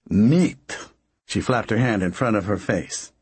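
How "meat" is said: disgusted exclamation. Acted.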